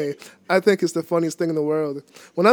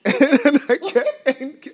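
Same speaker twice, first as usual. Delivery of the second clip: breaking up laughing, much higher; 7 semitones higher than usual. Real, not acted. Telling his rabbit joke to the interviewer, helplessly laughing. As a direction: struggle to get the words out through laughter. Cracking up at own story.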